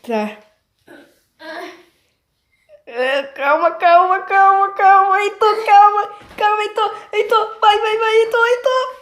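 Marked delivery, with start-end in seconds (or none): 2.8-8.9 panicked pleading. Pretend. Panicking, urging.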